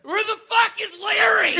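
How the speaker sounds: high, loud yell across the house; shouting. Pretend. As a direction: burst in hollering for Larry. Bursting into the foster home.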